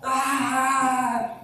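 high, loud imitated shriek of a tortured kid; playful mock scream; mock child scream. Preacher imitates the boy refusing to admit wrong, mock shriek. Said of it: "Like somebody was torturing this poor kid".

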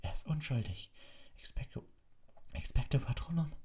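high, urgent plea; insist, then cast; pleading Harry. A narrator's reading. Harry insists Sirius is innocent, then casts the spell.

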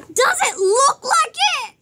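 high, loud tearful mock outburst; crying like a baby. Hurt child answers the teacher's question about walking, wailing. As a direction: wail it back, indignant and hammed-up. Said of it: "I was still crying like a baby".

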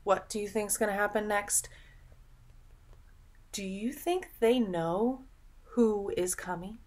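ordinary delivery

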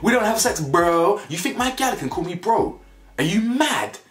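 high, mocking girlfriend voice. Imitating his girlfriend Jackie nagging him, high mock voice. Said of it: "she goes".

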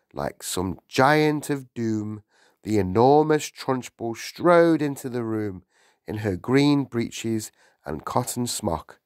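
usual speaking voice

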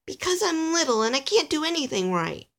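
high, plaintive confession; dejected little elf. Acted. Alistair explaining his fear to Nicholas, plaintive. Small elf doubts himself.

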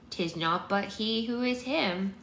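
put-on high, slow old woman voice; creaky old woman, cryptic; old crone voice. Old woman Maud speaks riddles.

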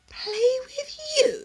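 scornful peacock. Acted. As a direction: look down your beak at him. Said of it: "said the peacock scornfully".

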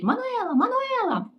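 very high calling out a name; mock shout. Receptionist yelling for her colleague, high mock call. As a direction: yell the name across the hotel. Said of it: "і кричить".

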